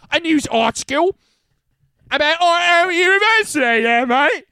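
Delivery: high loud mock Aussie; Australian accent. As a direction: put on a bloke's Aussie twang. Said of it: "You gotta do it with the accent".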